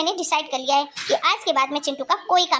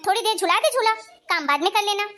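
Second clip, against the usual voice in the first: ordinary delivery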